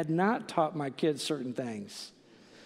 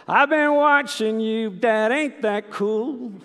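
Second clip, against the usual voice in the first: put-on high, slow, louder little-boy voice; 6 semitones higher, 6 dB louder than usual. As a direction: play the eager four-year-old. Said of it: "And the boy says".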